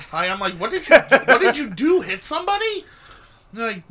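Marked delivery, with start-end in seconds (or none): 0.6-2.8 high-pitched incredulous alarm; alarmed questioning. Retold or imitated. Retelling his question to the pulled-over friend, alarmed. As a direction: ask in rising disbelief at what he has done.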